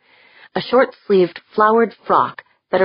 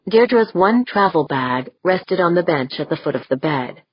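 ordinary delivery

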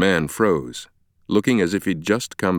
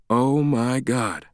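slow, higher-pitched realisation; stunned; 6 semitones higher, slower than usual. A narrator's reading. Stranger learns of Langdon's amnesia, stunned. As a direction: draw it out in shock. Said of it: "he stammered".

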